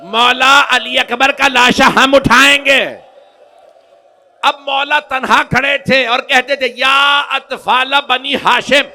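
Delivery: loud, high, wailing majlis recitation; full-force preaching. Acted. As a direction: cry it out to the mourners. (ऊँची आवाज़ में दर्दभरी मजलिस)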